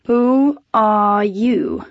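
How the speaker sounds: slow, bored, detached question; uninterested. Acted. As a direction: ask without any interest. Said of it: "he inquired without interest".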